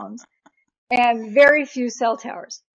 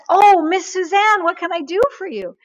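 effusive cruise man; 8 semitones higher, 6 dB louder than usual. Retold or imitated. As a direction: imitate an over-hearty customer greeting. Cruise man finally recognises her.

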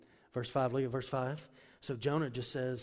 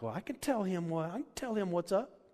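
rising, higher cocky quote; mock bravado; 7 semitones higher than usual. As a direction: puff up like you'll tell God off. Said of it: "we feel like".

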